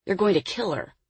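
plain reading voice

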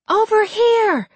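high, drawn-out scream, volume held back a little; screamed call. A narrator's reading. Jessica luring the creature away from children, screamed call. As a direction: scream it slowly to draw attention. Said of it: "she screamed as loudly as she could".

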